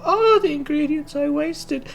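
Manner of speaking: put-on high girly half-orc. Half-orc blacksmith gushes at the bard in a high girly voice. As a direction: gush like an excited schoolgirl. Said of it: "she was like".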